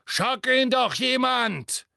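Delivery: called out. A narrator's reading. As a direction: yell to the others over the struggle. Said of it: "dass der Todesser rief". (laut um Hilfe gerufen, viel höher)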